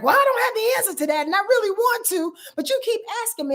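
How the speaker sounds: high-pitched mock whine of a bruised ego; mocking, put-upon protest; frustrated ego. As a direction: voice his ego high and defensive. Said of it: "It's almost like his ego was like".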